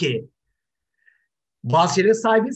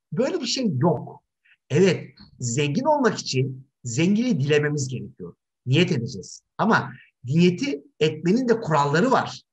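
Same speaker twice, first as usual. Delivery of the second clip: ordinary delivery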